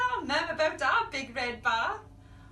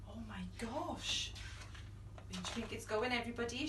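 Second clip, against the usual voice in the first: hushed amazement; 5 semitones lower, 8 dB quieter, faster than usual. Acted. Gasp, slow amazement.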